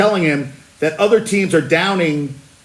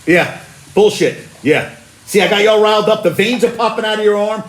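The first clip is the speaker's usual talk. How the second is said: ordinary talk, nothing marked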